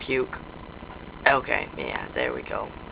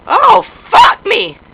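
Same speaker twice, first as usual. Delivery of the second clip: high, loud, stretched cry of shock; startled yell; 11 semitones higher, 10 dB louder, slower than usual. Pretend. Player taking a sudden fall, high startled yell.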